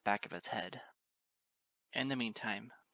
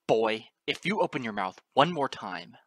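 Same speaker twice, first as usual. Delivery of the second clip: loud threatening father; angry threat; 10 dB louder than usual. A narrator's reading. Father threatening his son, loud angry warning. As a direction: warn him loudly, on the edge of violence.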